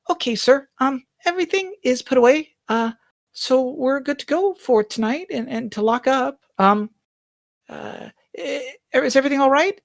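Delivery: bow and report to the master politely; eager-to-please housemaid; timid maid Bonnie. Acted.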